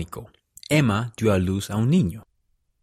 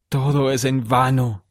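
mildly raised voice of lament; hopeless lament; 3 semitones higher than usual. A narrator's reading. Martin answering José's order, hopeless lament. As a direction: lament it, giving up. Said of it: "se lamentó Martin".

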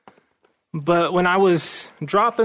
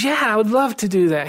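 excited brother; 5 semitones higher than usual. Retold or imitated. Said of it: "He was really excited".

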